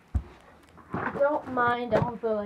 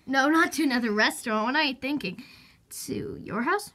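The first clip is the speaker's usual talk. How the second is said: usual speaking voice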